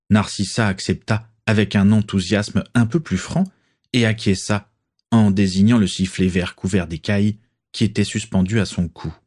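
usual speaking voice

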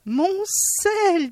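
high, shaky fearful cry over his salt; a frightened little man's wail; trembling voice. A narrator's reading. Man fearing for his salt, trembling voice. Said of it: "dit-il, la voix tremblante".

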